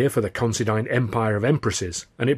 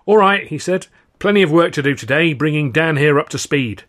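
brisk, louder stage manager rallying the troupe; clap-of-the-hands energy, louder and higher; lightly voiced brisk company boss; Alf, brisk; 5 semitones higher, 7 dB louder than usual.

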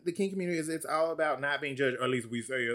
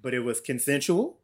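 normal talking voice

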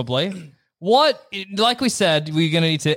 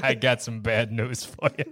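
low, softer tough-guy voice; tough-guy hero; 9 semitones lower, 6 dB quieter than usual. Acted. Hero breaks bad news to the kid, low and soft. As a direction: drop it right down for grim news.

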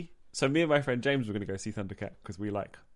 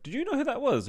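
excited. Retold or imitated. Teasing girlfriend after meeting.

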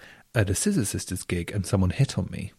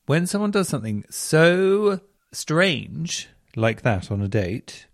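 usual speaking voice

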